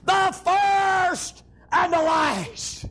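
very slow, held-out proclamation; preacher imitating a great divine voice; great trumpet voice. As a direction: stretch each title like a trumpet blast. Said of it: "a great voice as of a trumpet".

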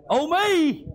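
ordinary delivery

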